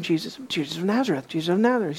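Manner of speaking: low, quick murmur; buzz of a crowd. Acted. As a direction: murmur it softly like passing crowd talk. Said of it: "buzz in the crowd".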